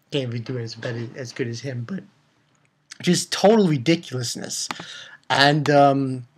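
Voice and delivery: ordinary talk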